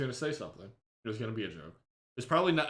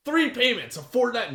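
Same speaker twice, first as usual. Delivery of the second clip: infomercial voice; 9 semitones higher, 6 dB louder than usual. Acted. Continuing the infomercial bit.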